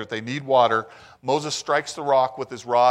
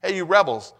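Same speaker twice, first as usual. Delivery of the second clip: high, loud mock jab at the crowd; mock scolding; 6 semitones higher, 5 dB louder than usual. Preacher mocking Moses' opening to the people, loud and high. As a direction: hurl "Hey, you rebels" with sarcasm. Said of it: "What a great way to start a speech".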